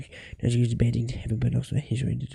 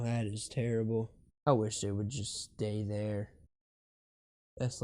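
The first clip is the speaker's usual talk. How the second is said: usual speaking voice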